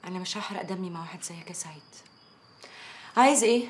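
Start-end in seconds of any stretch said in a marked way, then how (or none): 0.0-1.8 whispered, much softer and cold; contemptuous whisper. Acted. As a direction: breathe it out with disdain. (همس باحتقار)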